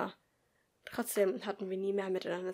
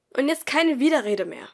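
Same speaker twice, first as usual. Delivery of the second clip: sharp, louder scolding, higher; angry teacher; 5 semitones higher, 10 dB louder than usual. Acted. Teacher scolds Henrik in class, stern and loud. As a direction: scold the pupil firmly. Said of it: "Die Lehrerin schien wütend zu sein".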